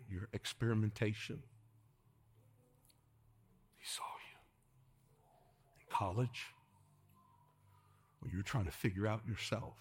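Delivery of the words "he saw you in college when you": hushed whisper for effect. Acted. Preacher whispers to congregation that He saw them. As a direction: drop to a breath.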